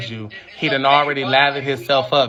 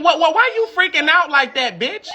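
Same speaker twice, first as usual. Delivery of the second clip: mock shrill yell; 14 semitones higher than usual. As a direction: shriek at them in a hammed-up high voice, laughing. Yelling at people in video.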